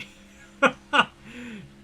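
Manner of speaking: loud burst of laughter. Real, not acted. Laughing at the sequel.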